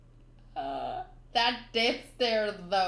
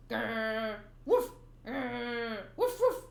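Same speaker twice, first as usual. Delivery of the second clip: dog barking; much slower than usual. Acted. Dog blocking the townspeople, growling and barking. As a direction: growl and bark like a guard dog. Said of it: "I don't wanna keep barking".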